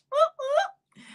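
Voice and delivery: two high, hooted whoops; celebratory whoop. Real, not acted. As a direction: hoot twice up high in celebration. Celebrates a sunny day.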